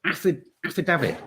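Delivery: AFFIDAVIT stumbled, restarted as affi-DAV-it, short A; struggling to pronounce. Real, not acted. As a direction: trip on affidavit, starting it twice, high. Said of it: "I can't say that".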